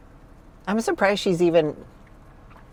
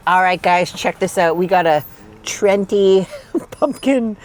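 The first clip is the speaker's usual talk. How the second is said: usual speaking voice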